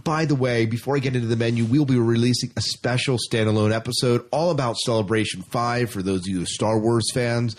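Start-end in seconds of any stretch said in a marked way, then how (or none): none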